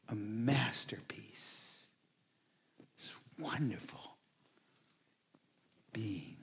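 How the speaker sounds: soft awed whisper; hushed, reverent whisper. Acted. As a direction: breathe it in wonder.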